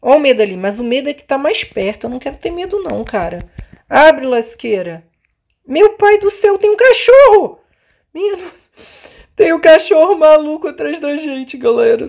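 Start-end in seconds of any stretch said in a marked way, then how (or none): 5.7-12.1 high alarmed cries; startled shriek at the dog; scared. Real, not acted. Crazy dog chasing them.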